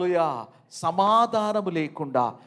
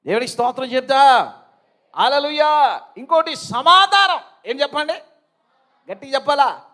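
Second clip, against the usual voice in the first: loud, high call for a response; rousing preacher's call; 8 semitones higher, 11 dB louder than usual. Real, not acted. Preacher calling the congregation to praise, rousing preaching. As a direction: call out to get the congregation answering.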